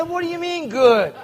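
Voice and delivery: can't believe what he just heard; incredulous retort. Retold or imitated. Baffled reply to sponsor's "good.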